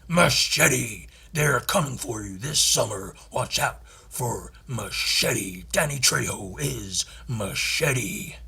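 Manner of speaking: slow, dramatic American trailer announcer; movie trailer voice. Pretend. Trailer announcer pitching Machete to the audience, dramatic. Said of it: "an American can, can say it's fine".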